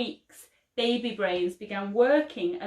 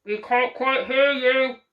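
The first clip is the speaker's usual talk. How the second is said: ground control; 3 semitones higher, 7 dB louder than usual. A narrator's reading.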